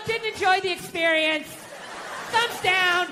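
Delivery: high-pitched mock complaint; mock critical review. Wife reviewing her husband like a business, mock critical.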